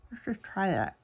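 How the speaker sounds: words round a pen in the mouth; pen in teeth. Real, not acted. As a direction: talk with a pen held in teeth. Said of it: "Don't talk with a pen in your mouth".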